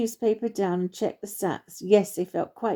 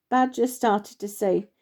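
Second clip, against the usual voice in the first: ordinary delivery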